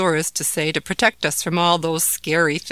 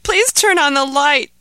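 even higher and louder, pleading harder; desperate begging; 10 semitones higher, 8 dB louder than usual. Acted. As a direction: beg again, more desperate. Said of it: "begged Ahmed".

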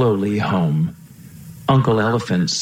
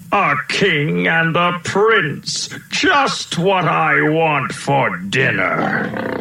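higher, slow and drawn-out lion voice; roaring lion; 7 semitones higher, much slower than usual. A narrator's reading. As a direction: relish each word like a hungry lion. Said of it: "roared the lion".

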